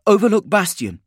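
high, loud, deliberate report; urgent military report. A narrator's reading. Trooper gives her posting to Spinoza, urgent report. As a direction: call out the posting to a superior.

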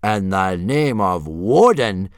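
slow, loud, swooping disbelief; drawn-out horrified question; appalled demand. A narrator's reading. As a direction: demand it word by word, aghast. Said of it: "demanded Gobber, blanching a little".

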